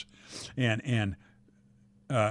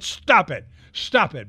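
raised-voice retold rebuke; firm interruption; 5 semitones higher, 7 dB louder, slower than usual. Actor cutting off his panicking co-star in rehearsal, firm and raised. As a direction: cut off someone's panic, higher and louder.

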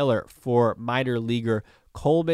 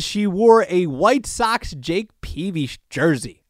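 ordinary delivery

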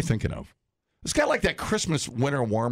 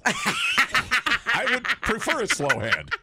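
laughing; 10 semitones higher, much slower than usual. Real, not acted. Laughing over song lyric.

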